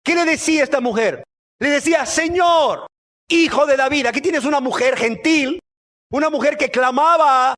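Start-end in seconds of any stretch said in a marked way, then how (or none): 2.1-4.1 high, raised-voice plea; acted cry to the Lord. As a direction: call out for the Lord's attention. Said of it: "que le clamaba".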